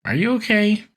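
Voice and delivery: squeaky high, baffled Krang question; a lightly voiced, puzzled Krang; mock concern. Krang voiced, suspicious of Shredder.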